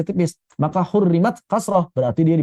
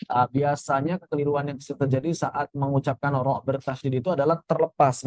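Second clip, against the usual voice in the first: ordinary delivery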